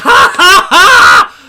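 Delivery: huge, high staged "HA, HA, HA"; very loud laugh. Retold or imitated. Waiter laughing at customers' table, loud pointed laugh. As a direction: belt out a forced laugh to be overheard. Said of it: "I laughed really loudly".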